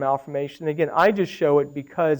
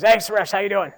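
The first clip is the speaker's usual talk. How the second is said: lightly imitated department head, pitched up; head of ENT; 3 semitones higher than usual. Head of ENT greeting the radiologist, lightly imitated voice. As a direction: mimic a colleague's hearty greeting, a bit higher. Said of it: "You should talk like this".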